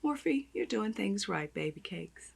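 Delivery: ordinary delivery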